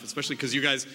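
normal talking voice

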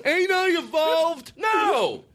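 high, drawn-out mock self-praise. Comedian mocking Bob's claim to have evolved, high and scornful. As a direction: raise the pitch and scoff at the very idea.

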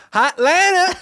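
"Hotlanta" squawked very high; exaggerated "Hotlanta". Shown as a demonstration. Defending his pronunciation to the teasing host, squealed "Hotlanta". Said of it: "the way I say".

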